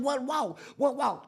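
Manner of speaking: high, barked question repeated; a confused guard yelling; mock guard yell. Guard shouting at inmates, high mock bark.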